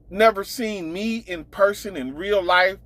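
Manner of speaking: ordinary talk, nothing marked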